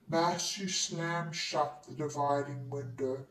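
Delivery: ordinary delivery, nothing marked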